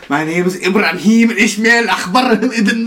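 high, loud mock Arabic accent. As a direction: reel it off in a put-on Arabic accent. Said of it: "the Arabic accent was so great".

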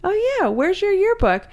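high, slow coaxing question; sweet mom voice. Retold or imitated. Mom gently questioning daughter.

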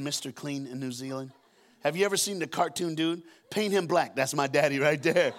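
ordinary delivery

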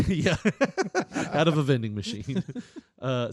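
ordinary talk, nothing marked

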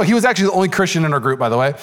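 normal talking voice

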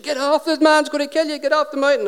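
put-on high-pitched panicked young lad; nervy mock shout in a high young voice; nervous shouting. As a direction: retell a frightened boy's warning, pitched right up. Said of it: "really nervous... He's shouting".

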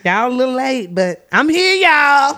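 high, loud hype greeting; excited. Real, not acted. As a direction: sing out the welcome. Greeting listeners, show host.

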